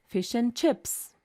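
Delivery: and reduced to a quick n, fish n chips; weak and. Shown as a demonstration. Teacher demonstrating a weak and in fish and chips. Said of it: "So and is a weak form here".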